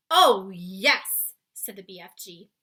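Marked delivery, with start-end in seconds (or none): 0.1-1.3 loud, firm giant reply. Acted. Giant insisting to Sophie. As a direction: answer matter-of-factly. BFG voiced in read-aloud.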